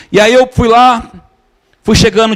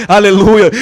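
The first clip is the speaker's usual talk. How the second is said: ordinary delivery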